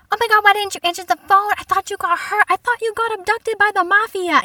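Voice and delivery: high, loud mock panic; hammed-up frantic worried parent; parent freaking out. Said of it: "they start freaking out".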